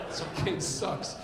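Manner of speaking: ordinary talk, nothing marked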